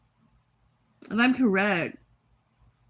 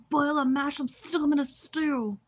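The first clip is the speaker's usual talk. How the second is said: Samwise quote voice; 6 semitones higher than usual. Retold or imitated. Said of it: "Lord of the Rings quote".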